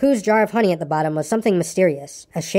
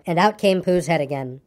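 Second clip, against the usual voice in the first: usual speaking voice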